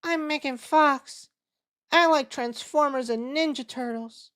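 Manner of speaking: a deliberately lame celebrity voice; Megan Fox impression. Admitting he can't do Megan Fox, high bad impression. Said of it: "can't even do a Megan Fox voice".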